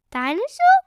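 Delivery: put-on high toddler voice; George guessing. A narrator's reading. George guessing a dinosaur, high. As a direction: guess hopefully. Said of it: "guessed George".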